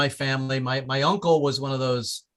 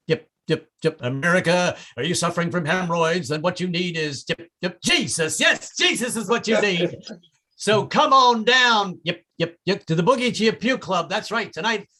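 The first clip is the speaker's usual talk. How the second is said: radio ad voices; 3 semitones higher than usual. Acted. Radio channel-flipping bit.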